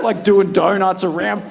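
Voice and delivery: normal talking voice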